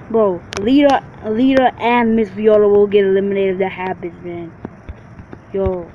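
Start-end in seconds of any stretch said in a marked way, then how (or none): none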